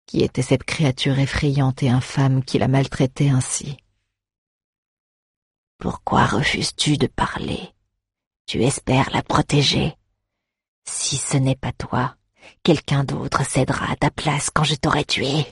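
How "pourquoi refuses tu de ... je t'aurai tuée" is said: cavernous undead voice. Acted. Undead interrogates the bard.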